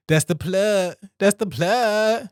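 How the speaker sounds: That's the plug stretched out slow and lilting; playful sing-song. Real, not acted. Wrapping up his ad plug to listeners, slow and playful. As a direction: savour it, slow and teasing. Playful repeat after laughing.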